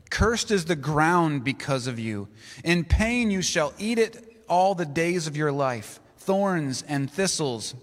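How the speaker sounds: ordinary talk, nothing marked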